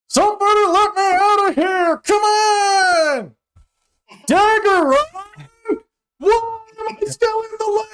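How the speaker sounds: very high, loud squeaky voice calling from inside the lamp; trapped genie yelling. Acted. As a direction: pound on the walls, shout to be let out.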